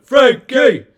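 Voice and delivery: high, loud call of a name; announcer shout. Acted. Calling out the name.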